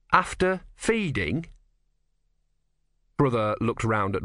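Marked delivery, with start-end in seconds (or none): 0.1-1.5 slow, high leading question; meaningful, higher-pitched query; pointed suggestion. Acted. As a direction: prompt with a drawn-out hint. Prompting hint.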